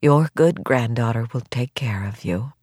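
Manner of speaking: low, hushed reassurance; gentle bedside tone; reassuring doctor. Acted. Doctor reassures sick granny.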